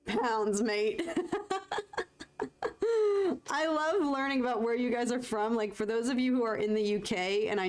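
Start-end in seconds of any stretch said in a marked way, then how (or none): 1.1-3.5 high-pitched burst of laughter; bright giggle at the joke. Real, not acted. Laughing at "pounds, mate.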